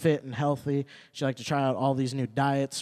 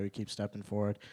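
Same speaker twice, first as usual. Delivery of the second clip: ordinary delivery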